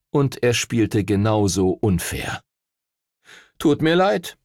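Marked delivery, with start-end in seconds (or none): 3.6-4.4 high, falsely sorry; mean grin. A narrator's reading. Rival greets the cheated team, gloating. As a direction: apologise through a nasty grin. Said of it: "mit einem gemeinen Grinsen".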